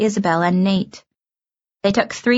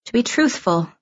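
ordinary delivery

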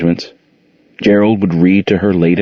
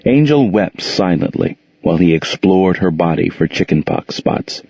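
ordinary delivery

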